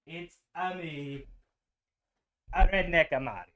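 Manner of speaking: playful mock Italian accent; Mario Italian accent. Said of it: "the Italian accent the whole time".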